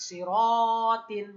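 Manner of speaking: সিরাতিন stretched slowly, loud; teacher modelling সিরাতিন slowly; slow model word. Shown as a demonstration. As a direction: hold each syllable of সিরাতিন. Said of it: "কি উচ্চারণ?".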